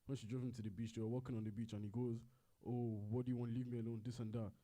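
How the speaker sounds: ordinary delivery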